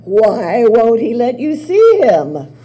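loud, slow, pressing question; loud suspicious demand. Acted. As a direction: lean in hard, stir the doubt.

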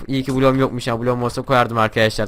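ordinary delivery

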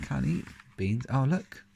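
plain reading voice

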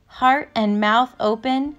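plain reading voice

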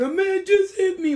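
high, loud mocking scream. Mocking a castmate who screams she was hit. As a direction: shriek it like a fake victim. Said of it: "she's screaming".

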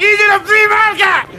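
bellowed political rant; ranting shout. Acted. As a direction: shout it at passers-by.

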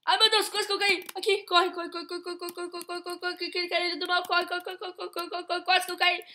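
breathless rapid run chant; being chased. Real, not acted. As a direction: chant it while fleeing in panic. Fugindo, quase cai. (fugindo em pânico, repetindo corre)

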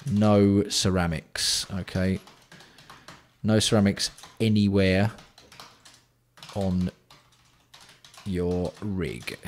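very slow, drawn-out words; dictating word by word; slow dictation. Real, not acted. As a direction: spell out the rule slowly, with long gaps. Dictating a rule while typing it.

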